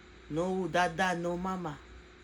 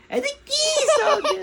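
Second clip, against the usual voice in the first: laughing; 15 dB louder than usual. Acted. Punchline with laughter.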